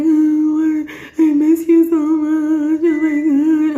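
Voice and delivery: high, drawn-out tearful whine; hammed-up sobby pleading; mock crying. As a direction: imitate yourself wailing down the phone. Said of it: "I was crying".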